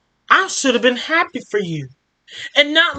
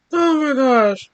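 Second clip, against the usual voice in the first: plain reading voice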